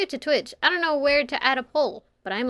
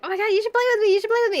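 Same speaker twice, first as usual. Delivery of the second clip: high, mock-pleading invite; overeager gamer; 10 semitones higher, much faster than usual. Streamer imitating fans begging her to play, high mock plea. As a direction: beg in a high fangirl whine. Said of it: "a lot of people were like".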